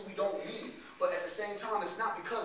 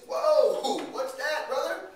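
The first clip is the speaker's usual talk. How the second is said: high-pitched, drawn-out whoa of wonder; amazed reaction; 7 semitones higher, 5 dB louder, much slower than usual. Acted. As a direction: react wide-eyed with a rising question. Acted reaction to what friend pulled out.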